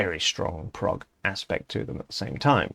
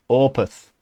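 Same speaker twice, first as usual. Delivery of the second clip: put-on Yorkshire accent; 5 semitones higher, 7 dB louder than usual. His brain replaying [name]'s Yorkshire "Opeth". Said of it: "now my brain goes".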